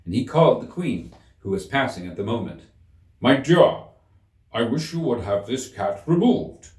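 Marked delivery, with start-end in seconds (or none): 3.2-6.7 louder calling, lightly pompous; King calling. A narrator's reading. King calls the Queen to have the cat removed, louder. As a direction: call out to your wife.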